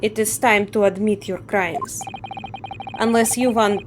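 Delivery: put-on Russian accent. Guard threatens prisoner with the ocean, Russian accent. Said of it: "I assume he's Russian".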